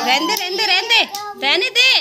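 loud, high repeated call to stop. Real, not acted. Mother calls out to the kids to leave it, loud and rising. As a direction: stop them, rising each time. Telling off kids during aarti.

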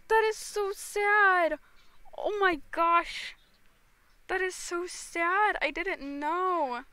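high, drawn-out wail of dismay; loud distressed cry, words stretched slowly; shocked and upset. Real, not acted. Streamer reacting to Technoblade news, shocked and upset. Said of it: "I'm just in shock".

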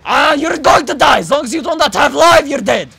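loud taunting yell. Pretend. Player threatening an opponent in game, loud taunting yell. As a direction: yell a death threat at full voice.

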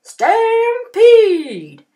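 high, very loud stampede cry; cowboys yelling. A narrator's reading. Hungry cowboys charging, very loud high yell. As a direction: holler it across the range. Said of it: "The cowboys yelled".

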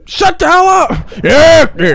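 high, loud outburst; agitated yell. Real, not acted. Player telling the game to shut up, agitated yell. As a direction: yell it back at the noise.

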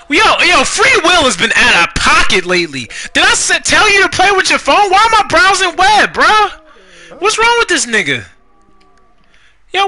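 high-pitched exasperated rant at the game; getting mad. Real, not acted. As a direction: protest in rising disbelief. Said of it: "I'm getting mad".

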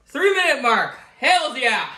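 high, loud whoop; excited cheer. Real, not acted. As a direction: cheer a small victory. Celebrating lasting through the burn.

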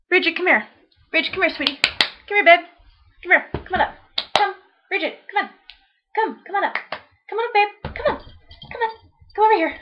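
calling the dog sweetly, pitched well up; high coaxing call to dog. Real, not acted. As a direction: coax the puppy over in a high singsong.

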